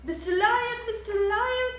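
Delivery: high, loud calling. Acted. Animals calling the lion to his den, loud high call.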